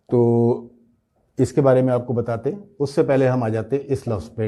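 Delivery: ordinary delivery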